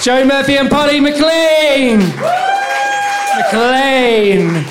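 loud, slow, drawn-out host announcement; booming compere calling out names; announcing. Real, not acted. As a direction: call the names out to the room. Host announcing act names loudly.

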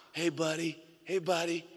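a hint of a loving father saying buddy; lightly voiced dad. Imitating dad's greeting.

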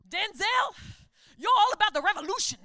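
loud, high, fast preacher imitation; hammed-up preacher proclamation; Southern Baptist preacher. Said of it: "She turned into a Southern Baptist preacher".

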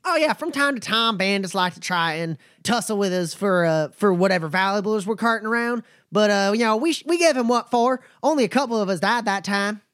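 a wary but friendly trail boss sizing folks up; nomad Bumper. Acted. Bumper answers Roy.

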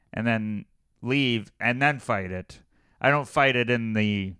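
usual speaking voice

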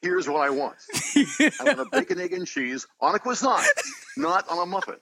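high, commanding cartoon hero; Lion-O voice. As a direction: order breakfast like a heroic cat lord. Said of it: "As Lion-O ordering a croissant".

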